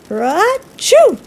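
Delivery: big bear sneeze, high and loud. Acted. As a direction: burst out a huge sneeze. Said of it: "He blows and he sneezes".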